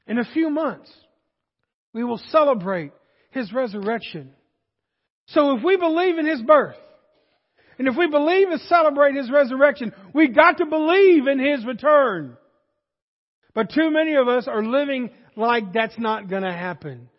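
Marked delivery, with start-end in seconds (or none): 5.3-12.4 loud, high, building sermon appeal; forceful preaching. Real, not acted. Preacher urging belief in Christ's return, forceful preaching. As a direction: press the point home to the congregation.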